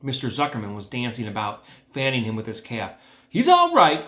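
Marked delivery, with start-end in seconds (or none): 3.3-4.1 much higher, louder anxious cry; cried out. A narrator's reading. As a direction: reassure the crowd at full voice. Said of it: "cried Zuckerman".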